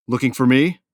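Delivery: loud taunting challenge; loud threat. Acted. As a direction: announce yourself with menace. Bursting in, threatening.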